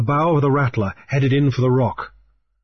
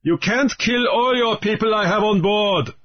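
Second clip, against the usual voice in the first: higher, taunting pirate voice; Raoul's defiant answer; 9 semitones higher than usual. A narrator's reading. Pirate Raoul taunts Grief from the deck, defiant. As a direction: throw the dare back up at him.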